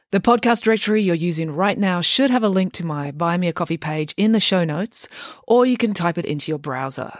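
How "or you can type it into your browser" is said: usual speaking voice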